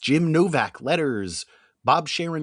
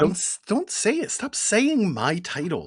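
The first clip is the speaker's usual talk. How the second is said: indignant protest; 6 semitones higher than usual. Real, not acted. Mock quarrel over saying titles.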